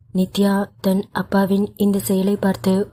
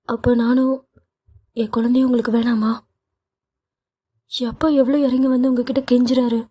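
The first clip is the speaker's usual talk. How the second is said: higher, tearful pleading reproach; 4 semitones higher, faster than usual. Acted. Wife in tears accusing her husband, higher tearful voice. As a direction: let the hurt rise through the pitch. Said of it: "Nithya கண் கலங்கியபடி".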